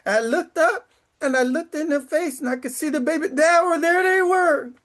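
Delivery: fighting back tears as the memory hits; real emotion, crying through the words; choked up. Real, not acted. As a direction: voice cracking high with tears, welling up. Seeing former students as officers.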